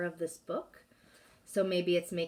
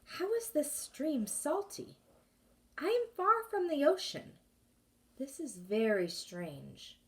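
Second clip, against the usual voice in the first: wondering; 8 semitones higher than usual. Acted. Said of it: "Minley began to wonder".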